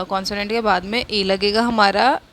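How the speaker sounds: ordinary delivery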